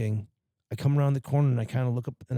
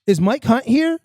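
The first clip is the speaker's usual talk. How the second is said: high, loud innocent mom voice; sweetly asking the whole room; imitating mom paging a customer; mom on the phone; 10 semitones higher, 7 dB louder, slower than usual. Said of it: "She's like".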